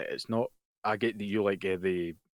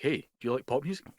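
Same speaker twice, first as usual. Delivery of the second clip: mock advert pitch; 7 semitones higher than usual. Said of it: "be like".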